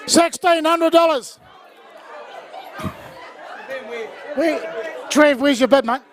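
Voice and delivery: rapid auction chant, calling bids; auctioneer calling. Acted. Runs the charity auction.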